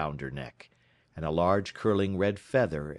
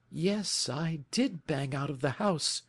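Mary Sutherland voice; 8 semitones higher, 3 dB quieter than usual. A narrator's reading. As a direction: lift into Mary's earnest, simple voice.

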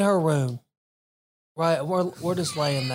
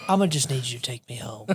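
deadpan request; 7 semitones lower, 5 dB quieter, faster than usual. Retold or imitated. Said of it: "I said".

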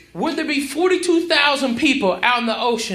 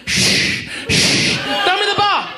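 mock plea; 7 semitones higher, much slower than usual. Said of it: "How about this fucking idiot".

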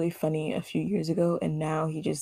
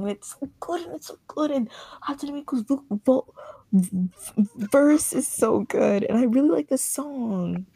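excited gushing; 7 semitones higher, 4 dB louder than usual. Real, not acted. Gushing about [name].

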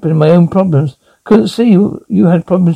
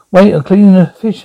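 usual speaking voice

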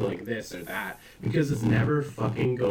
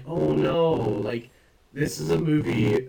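higher, louder mock dismay; dismayed reaction; 5 semitones higher, 4 dB louder than usual. Film fan recalling being saddened by movie jokes, dismayed. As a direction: blurt it in exaggerated dismay. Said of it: "because I'm like".

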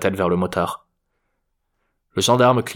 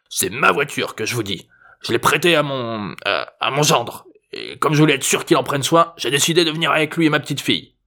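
cranky grandpa, mostly pitched up; insistent old man; 5 semitones higher than usual. A narrator's reading. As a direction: explain haltingly as a stubborn old geezer. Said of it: "précisa-t-il".

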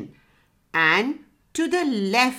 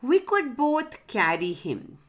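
ordinary delivery, nothing marked

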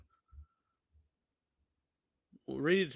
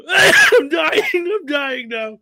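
high, loud laughing shout; drawn-out words over hard laughter; real helpless laughter; 10 semitones higher, 12 dB louder, much slower than usual. Real, not acted. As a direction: gasp it out while cracking up. Cracking up after own impression.

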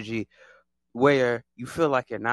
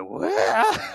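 mock shocked what; 9 semitones higher than usual. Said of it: "And I'm like".

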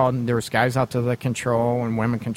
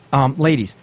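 ordinary delivery

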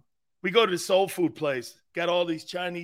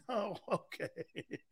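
laughing reply; 14 dB quieter, much slower than usual. Retold or imitated. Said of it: "his reply, laughing".

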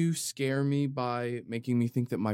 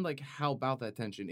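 ordinary delivery

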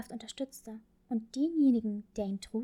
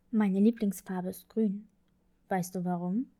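father voice; 4 semitones lower, 4 dB louder than usual. Acted. Father talks to daughter at bedtime.

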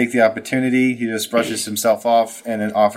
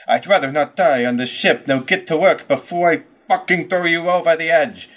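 higher, angry threat; 7 semitones higher than usual. Acted. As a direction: snap at him to get working. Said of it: "Damon's face and says".

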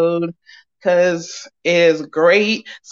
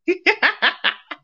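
evil laugh; 10 semitones higher than usual. Real, not acted. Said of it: "that's my evil laugh".

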